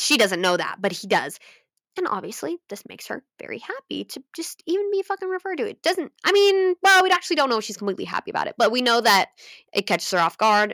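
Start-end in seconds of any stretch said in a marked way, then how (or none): 2.7-8.5 excited rush. Real, not acted. Excited rushing pitch.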